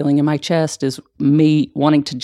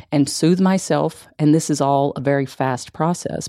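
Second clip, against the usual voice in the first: normal talking voice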